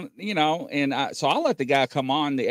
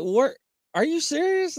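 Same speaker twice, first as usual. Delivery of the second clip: mock disbelief; 13 semitones higher than usual. Retold driver disbelieving Bigfoot story.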